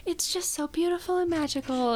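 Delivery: mock-dreamy theater lover's rapture; gushing. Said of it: "every time I talk about theater I'm like".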